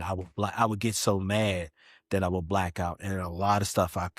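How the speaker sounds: ordinary talk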